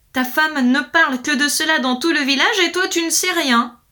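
high, loud reproach; angry lord. A narrator's reading. Lord confronts man with wife's gossip, angry. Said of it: "en colère".

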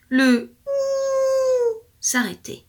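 slow, high howled words; howling narration. A narrator's reading. Storyteller howling as the noise stops. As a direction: draw it out like a fading howl.

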